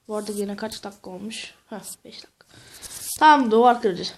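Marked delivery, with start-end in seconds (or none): 0.1-2.5 whispered aside; whispering. Real, not acted. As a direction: whisper the question to yourself. Aside, unvoiced.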